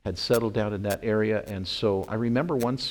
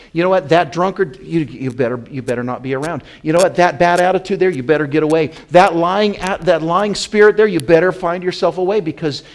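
loud, high, forceful preaching warning; stern warning; 7 semitones higher, 11 dB louder than usual. Acted. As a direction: raise your voice and urgently warn the flock away.

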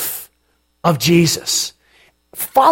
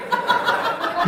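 laugh; 8 dB quieter than usual. Real, not acted. Laughs at Herod.

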